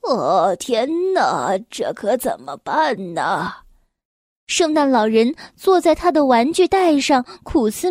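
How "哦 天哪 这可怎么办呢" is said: put-on low, fretful old man voice; worried Santa. A narrator's reading. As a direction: sigh it out as a troubled Santa. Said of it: "圣诞老人急坏了".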